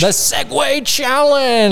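high, drawn-out game-show title call; hammed-up announcer, slow and high; mock announcer.

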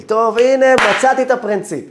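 high, loud burst of self-encouragement; excited discovery. Retold or imitated. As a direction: act out a sudden eureka moment. Acts out his sudden discovery. (התלהבות של תגלית, בקול רם וגבוה)

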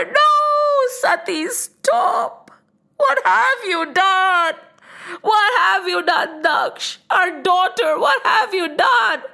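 high-pitched, stretched-out cry of dismay; anguished shout. A narrator's reading. Mother confronts Daksh over their daughter, anguished shout. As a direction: call out as a horrified mother, dramatised. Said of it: "shouted her mother".

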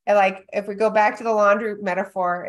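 ordinary delivery

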